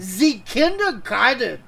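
put-on loud, high, slow German accent; movie villain German accent. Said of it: "you're sounding a little Hitlerish".

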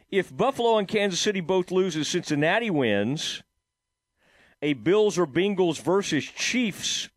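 ordinary talk, nothing marked

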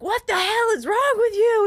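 high, loud imitated scream; retold screaming. Traveler imitating the lady screaming at her, outraged. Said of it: "screaming at me".

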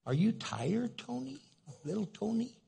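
put-on high, coaxing grandma talking to a little child; Cindy. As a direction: lift each question up sweetly for a small grandchild. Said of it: "Cindy goes".

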